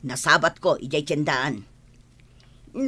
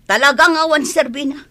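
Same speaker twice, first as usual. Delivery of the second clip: loud, scornful declaration; contemptuous loud dismissal; angry outburst; 5 semitones higher, 7 dB louder than usual. Acted. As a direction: spit out the verdict. Mother repeats judgement loudly.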